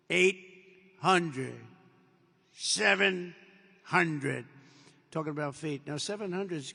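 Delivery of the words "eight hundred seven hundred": loud, very slow altitude callout, each word spaced out; computer voice callout. Retold or imitated. Cockpit computer calling out the altitude, loud slow callout. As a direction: boom out the numbers like a cockpit computer. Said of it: "you have the computer voice".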